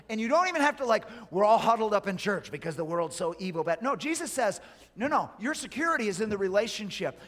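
high, loud, driving sermon delivery; full-force preaching. Real, not acted. Preacher warning those not listening, forceful. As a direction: preach it out to the whole room.

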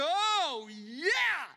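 high, loud whoop of joy; excited cheer. Pretend. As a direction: cheer it out like a thrilled girlfriend.

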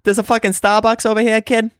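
high-pitched Boston bro; Boston accent. Acted. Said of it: "you do, you do that accent".

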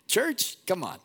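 high, exasperated mock rebuke; imitating God chiding the church; God scolding. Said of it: "he would start with us and say".

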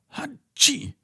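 very high squeaky sneeze. A narrator's reading. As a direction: sneeze from a tickled nose. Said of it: "machte sie".

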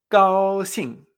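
GAOXING, GAO alto y fuerte, XING cae; sostén GAO y deja caer XING de golpe; tono uno cuatro. Shown as a demonstration. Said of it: "Uno cuatro". («gaoxing»: alto plano y luego descendente)

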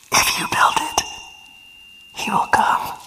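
hushed, breathy voice from the corn; mysterious whisper. Retold or imitated. The voice in the corn calls to Ray, whispered. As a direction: whisper it like a ghostly voice on the wind. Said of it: "And the voice says".

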